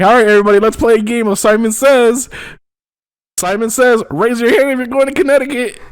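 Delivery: announcer voice. Pretend. Pilot announcing game to cabin.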